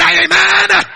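loud call for Amen. Real, not acted. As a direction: shout it back. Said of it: "say louder".

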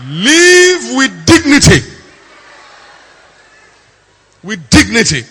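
loud, high, drawn-out preacher's cry; forceful exhortation. Real, not acted. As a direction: belt it out as a command to the crowd. Exhorts the listeners.

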